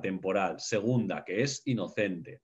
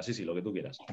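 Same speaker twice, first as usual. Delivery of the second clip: dismissive shrug; 6 semitones lower, 8 dB quieter, faster than usual. Retold or imitated. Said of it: "A mí me dicen".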